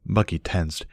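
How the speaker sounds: ordinary delivery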